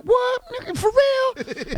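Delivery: high, slow stunned disbelief; shocked disbelief. Retold or imitated. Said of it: "they're like".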